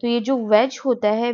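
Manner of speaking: ordinary talk, nothing marked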